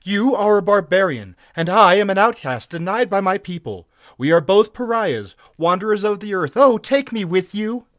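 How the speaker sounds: high, loud, impassioned heroine; passionate plea. A narrator's reading. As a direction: beg to be taken along. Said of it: "she answered passionately".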